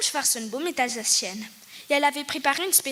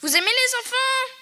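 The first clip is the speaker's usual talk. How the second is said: put-on sweet old hostess; 11 semitones higher, 5 dB louder than usual. A narrator's reading. Hostess asks the boys.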